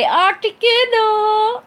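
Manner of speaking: Articuno! called out high, loud and stretched slowly; excited shout. Real, not acted. Reading chat, she shouts out her tattoo's name excitedly. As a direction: cry the name out with delight.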